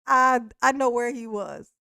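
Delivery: ordinary talk, nothing marked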